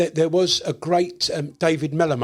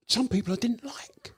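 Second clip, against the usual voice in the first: confiding whisper, breathy and hushed; 7 semitones higher, 6 dB quieter than usual. Real, not acted. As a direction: let it slip like a secret. Confiding a dislike of guests.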